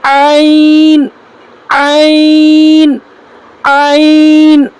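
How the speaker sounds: عین stretched very slowly from the middle of the throat; slow, deliberate recitation of عین; drawn-out letter demo. Shown as a demonstration. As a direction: hold each عین long and slow, a teacher's model. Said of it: "ابھی میں بتایا تھا نا".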